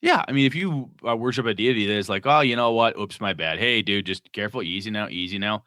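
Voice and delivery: ordinary talk, nothing marked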